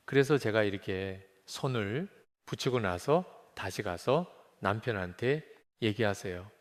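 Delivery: ordinary talk, nothing marked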